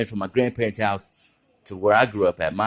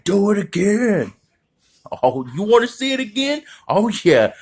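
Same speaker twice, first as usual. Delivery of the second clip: hyped crowd; 10 semitones higher than usual. Retold or imitated. Crowd begging kid for another trick, high hype. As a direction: egg him on like fans.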